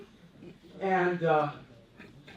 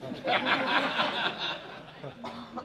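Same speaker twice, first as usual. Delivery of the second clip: breathy laugh; 7 semitones higher than usual. Real, not acted. Laughing at dope line.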